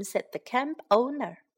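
normal talking voice

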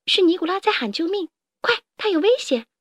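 high, urgent alert; urgent alarm. A narrator's reading. A friend warns that Nicolas is in danger, urgently alarmed.

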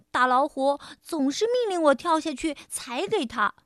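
plain reading voice

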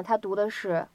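ordinary talk, nothing marked

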